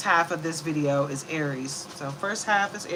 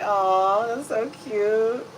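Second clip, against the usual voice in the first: slow fond coo; cooing aww; 4 semitones higher, 5 dB louder, much slower than usual. Retold or imitated. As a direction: coo over something cute. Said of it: "I was like".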